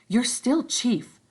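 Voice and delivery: high whispered reassurance; Piggy, hushed. Acted. Piggy voiced in story.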